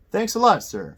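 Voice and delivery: loud, high burst of thanks, then dropping; eager, grateful outburst; excited exclamation. A narrator's reading. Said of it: "Frank exclaimed, with a surge of excitement".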